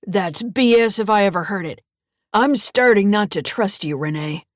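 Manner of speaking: put-on loud, gruff old man; gruff grandfather. Grandfather angry.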